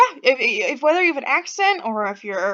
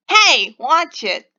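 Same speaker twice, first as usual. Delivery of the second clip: loud, higher, slow Boston warning; terrible Boston accent; 4 semitones higher, 9 dB louder, much slower than usual. Acted. Stranger bumped by Pixie in a shop, angry Boston accent. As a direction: snap it out in a bad Boston accent. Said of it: "my terrible Boston accent".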